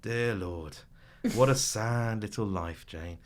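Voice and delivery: slow, withering imitation of a snob; disdainful TV contestant. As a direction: drag out the scorn on "what a sad little life". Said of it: "he kind of looks at her and he goes".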